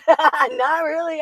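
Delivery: says it again through a slow laugh. Real, not acted. To the viewer about not saving for retirement, laughing. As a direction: laugh it out, drawn out slowly. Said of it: "repeat of not really".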